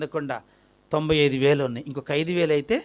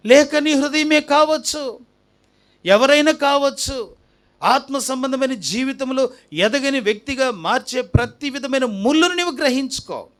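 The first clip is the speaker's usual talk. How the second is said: raised, higher-pitched preaching; forceful preaching; 7 semitones higher than usual. Real, not acted. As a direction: press each point on the congregation. (గట్టిగా ప్రసంగిస్తూ)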